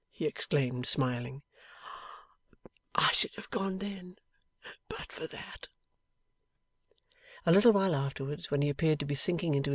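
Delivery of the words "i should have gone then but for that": dying man's whisper. A narrator's reading. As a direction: barely get the words out, fading. Dying Eugene speaks.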